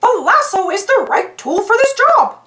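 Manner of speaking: high cartoon-mouse voice, cheerful and sure; little hero picking the right tool; Mickey Mouse voice. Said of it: "said Mickey".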